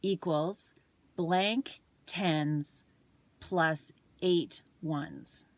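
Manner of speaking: blank tens plus eight ones spelled out slowly and evenly; slow read-out of the problem. Shown as a demonstration. Reading the math question aloud, slow and deliberate. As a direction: read the problem word by word to the viewer. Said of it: "So the number is".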